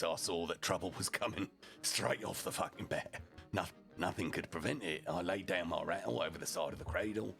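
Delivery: ordinary delivery, nothing marked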